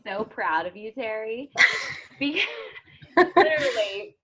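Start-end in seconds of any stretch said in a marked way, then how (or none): 1.8-4.2 louder, laughing through the word; laughing emotionally. Real, not acted. Coach proud of Terry, laughing through emotion. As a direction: laugh it out, moved. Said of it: "I'm gonna get emotional".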